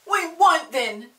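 loud, slow, furious refusal. Acted. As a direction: dig your heels in, loudly. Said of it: "said Peter furiously".

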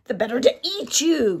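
loud pouncing wolf cry; werewolf. A narrator's reading. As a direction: leap out and roar it. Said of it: "cried the wolf".

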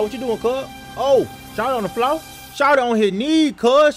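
usual speaking voice

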